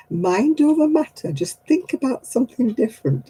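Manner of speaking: high preachy advice; mocking physiotherapist. Mocking the physiotherapist's advice, high preachy tone. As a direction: parrot the glib advice. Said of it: "feel like saying to the physiotherapist".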